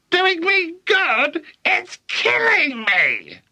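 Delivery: high, drawn-out screech; screaming. A narrator's reading. Grandma protesting to George about the medicine, screaming. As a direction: screech it slowly, wailing high. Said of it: "she screamed".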